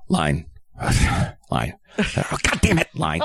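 sighing, snapping at himself over a flubbed line; mock frustration, cursing his own mistake; frustrated actor. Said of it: "you can literally hear me".

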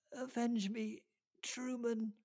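high, weak, raspy dying plea. A narrator's reading. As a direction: rasp it out while dying. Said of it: "her weak, raspy voice".

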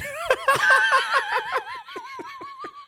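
squealing burst of laughter; helpless high laughter; high shrieking laugh. Real, not acted. Big laughing fit.

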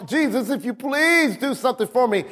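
high, imploring mock prayer; plaintive plea pitched high, acted out; mock pleading. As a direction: imitate someone begging for help. Acts out the wrong, begging posture.

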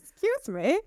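high, incredulous little outburst; mock indignant. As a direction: act affronted, pitched well up. Indignant at unequal pay.

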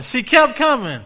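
loud, high preacher's cry; rising sermon climax; preaching shout. Real, not acted. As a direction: lift it up and shout it out.

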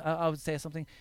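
ordinary delivery

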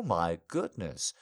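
ordinary talk, nothing marked

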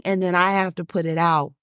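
ordinary talk

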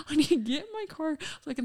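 high, loud, hurried rush of words; retold urgency. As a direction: blurt it out fast, as if desperate to escape.

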